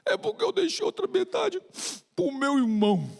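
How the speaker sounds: put-on high little boy's reply; child voice. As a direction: say it like a small boy. Child explains to the doctor. (voz de criança)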